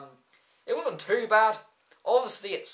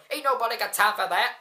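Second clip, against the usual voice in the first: lightly imitated wrestler catchphrase, higher and louder; Darren Young; 5 semitones higher, 5 dB louder than usual. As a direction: toss out a catchphrase in a mock voice. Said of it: "Darren Young go, going".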